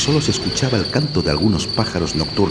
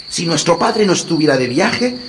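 put-on high, indignant young boy; 10 semitones higher, 4 dB louder than usual. A narrator's reading. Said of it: "dijo Hansel".

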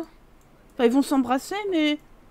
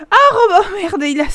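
laughing; 5 semitones higher, 7 dB louder than usual. Real, not acted. Sees Robin's outfit, bursts out laughing.

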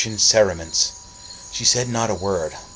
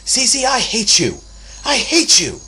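put-on much higher, louder, slow enraged boy; furious scream; 9 dB louder, slower than usual. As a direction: scream your hatred into the night.

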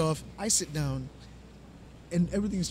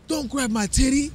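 loud, slow, higher mock outrage; retold angry yell; 6 semitones higher, 6 dB louder than usual. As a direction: shout it like an offended lady on the train. Lady yells at groper on train.

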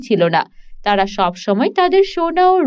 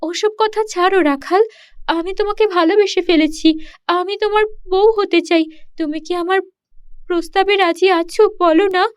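put-on higher, sweet-toned young mermaid voice, pleading; mermaid princess; 6 semitones higher than usual. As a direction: coax the shepherd into marrying her. (উঁচু গলায় আবদার করা জলপরি)

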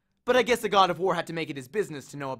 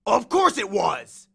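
loud, slow, raised-pitch retort; angry insistence; 4 semitones higher, 8 dB louder, slower than usual. Acted. Ares rejects Percy's accusation of lying, angry and loud. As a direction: insist loudly, stretching the words. Ares challenged, snaps back.

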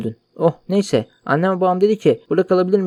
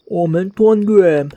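"Aman tanrım" drawn out very slowly and louder, as "omen tonbyem"; mock dramatic gasp; 3 semitones higher, 4 dB louder, much slower than usual. Shown as a demonstration. Streamer refusing to take the game seriously, "Aman tanrım" drawn out mockingly. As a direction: ham up "Aman tanrım" slowly, like a cheesy dub. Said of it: "Böyle konuşacağım".

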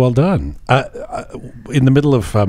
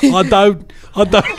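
higher hammed-up Cockney; mock Cockney accent; 6 semitones higher than usual. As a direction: play a baffled wise man in Cockney.

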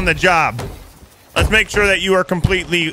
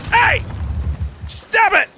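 sudden yell; 13 semitones higher, slower than usual. Real, not acted. Yells at players attacking the ship: sharp shout. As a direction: yell at them to stop now.

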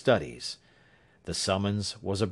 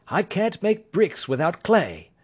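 higher, assured detective voice; Holmes; 9 semitones higher, 3 dB louder than usual. A narrator's reading. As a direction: Sherlock Holmes reassuring his worried client, higher assured voice.

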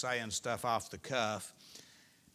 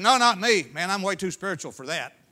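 higher, louder cocky brush-off; mocking boast; 6 semitones higher, 12 dB louder than usual. Preacher mocking Peter's denial, cocky and loud. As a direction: toss it off like a smug show-off.

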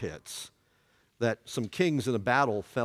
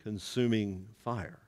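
slow solemn scripture; 2 semitones higher, 3 dB louder, much slower than usual. Shown as a demonstration. Preacher reading Hebrews to the class, slow and solemn. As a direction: let consuming fire sink. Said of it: "Hebrews chapter ten".

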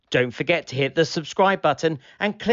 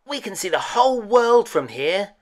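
put-on higher, louder, excited little boy; Sammy the boy; 8 semitones higher, 4 dB louder than usual. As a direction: call it out like a thrilled kid on a wall. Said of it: "Sammy said to Tom Rabbit".

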